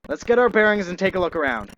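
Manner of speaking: usual speaking voice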